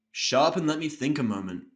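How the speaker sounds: a mild, irritated snap; understated annoyance, cutting her off; lightly snapped at the nurse; snapping. Acted. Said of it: "I snap at her".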